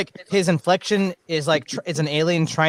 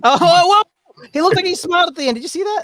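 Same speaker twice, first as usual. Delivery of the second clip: high, loud surprised cry; shocked; 14 semitones higher, 5 dB louder than usual. Real, not acted. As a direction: caught completely off guard. Shocked reaction to video.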